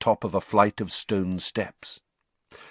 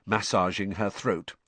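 plain reading voice

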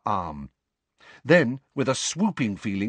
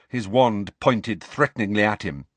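ordinary talk

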